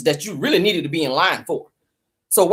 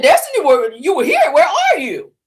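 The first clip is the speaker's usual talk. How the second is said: the Lord scolding; 6 semitones higher than usual. Retold or imitated. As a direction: play God chewing her out, pitched up. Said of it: "The Lord was saying".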